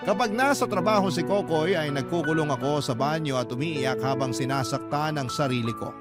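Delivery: usual speaking voice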